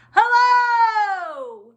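long, high, full shout; as loud as possible. Shown as a demonstration. Teacher showing children opposite hellos, as loud as possible. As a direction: holler the greeting and hold it. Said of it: "as loud as we can".

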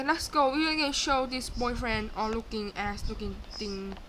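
ordinary delivery

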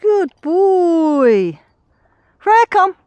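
high, loud, sing-song praise; excited dog praise. Real, not acted. Owner praising and calling the dogs, loud sing-song. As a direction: gush over the dogs at full volume.

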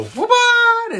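very high, loud showman's shout; magician's reveal. Shown as a demonstration. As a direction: pop it out like a stage magician. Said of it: "and then you go".

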